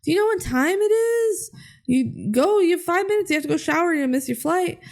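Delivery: hammed-up scolding mother; scolding mom. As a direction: rush him like a frantic mom. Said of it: "Jules is like".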